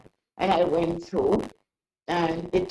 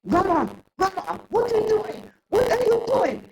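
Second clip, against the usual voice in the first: higher, lightly voiced worried grandson; alarmed grandson; 10 semitones higher than usual. Grandson sees grandma by the car, lightly voiced alarmed calling. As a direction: call out like a startled grandson.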